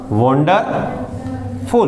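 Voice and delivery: a slow model reading for the class; drawn-out model word. Shown as a demonstration. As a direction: stretch wonderful out slowly, syllable by syllable. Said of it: "ये क्या हो गया?". (Wonderful को धीरे-धीरे खींचकर पढ़ना)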